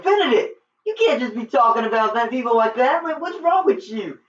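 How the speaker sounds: high-pitched, exasperated protest; agitated scolding. Retold or imitated. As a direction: imitate someone frantically telling off a friend. Retelling an urgent confrontation.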